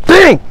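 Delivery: a sharp high imitated crash of a phone; phone-hitting-the-floor noise; mock bang. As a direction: blurt a quick bang sound. Phone slung on ground.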